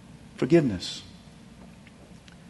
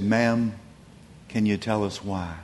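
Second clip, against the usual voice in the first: gentle question; 5 semitones lower, 7 dB quieter than usual. Retold or imitated. Tutu gently questions the widow.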